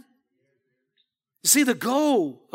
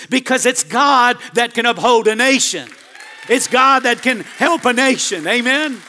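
preaching at full force; 2 semitones higher, 6 dB louder than usual. Acted. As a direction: drive the point home to the congregation. Preacher repeats point, louder.

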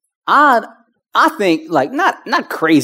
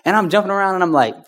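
ordinary delivery

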